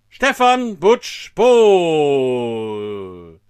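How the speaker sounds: big show announcer voice; announcer intro. Acted. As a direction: build up the guest like a gala host. Hyped show-host introduction.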